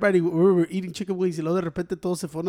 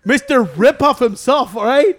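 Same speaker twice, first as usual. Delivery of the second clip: mocking title; 4 semitones higher, 6 dB louder, slower than usual. Mocking friend as a rip-off.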